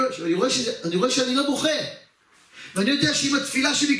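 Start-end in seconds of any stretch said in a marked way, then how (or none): none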